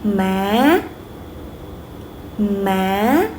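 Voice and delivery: "Ma" swept upward at the end; rising tone. Shown as a demonstration. Teacher repeating the second tone, voice going up. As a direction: say each "Ma" going up like a question. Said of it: "Go up, right?".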